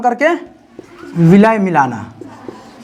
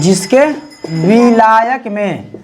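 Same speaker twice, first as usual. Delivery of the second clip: slow, spaced-out dictation voice; teacher dictating notes word by word; much slower than usual. Acted. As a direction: read each phrase slowly for the class to write. Dictating a definition to class.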